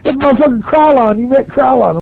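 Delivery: high, awed retold exclamation; high-pitched, fired-up quoted voice; excited coworker voice. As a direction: imitate a buddy thrilled about a local legend. Coworkers thrilled he met legend.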